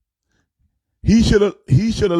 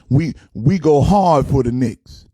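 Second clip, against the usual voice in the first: normal talking voice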